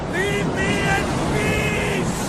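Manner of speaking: high, slow cry into the storm; anguished slow shout over the wind; desperate shout. Acted. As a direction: drag out a pleading yell. Desperate man on cliff edge.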